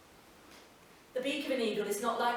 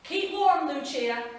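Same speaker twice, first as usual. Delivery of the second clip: Andrew, parting call; 6 semitones higher, 8 dB louder than usual. Acted. Postman calls goodbye.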